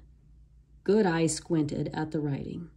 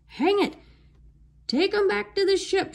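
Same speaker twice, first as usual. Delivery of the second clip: high furious pirate bellow; pirate shout; 14 semitones higher, 3 dB louder than usual. A narrator's reading. Pirate captain shouts to take the kids back to the ship. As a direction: shout the order across the beach. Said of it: "shouted Captain Bones".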